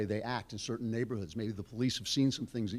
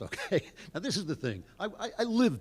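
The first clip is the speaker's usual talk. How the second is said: higher, laughing through the words; chuckling; 8 semitones higher than usual. Real, not acted. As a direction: let a real chuckle spill into the line. Chuckles after joke about lust.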